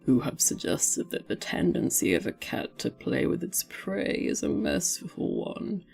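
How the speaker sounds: low, lazy cat voice, lecturing smugly; a cat explaining cruelty as mercy. Acted. Reading the cat's lines.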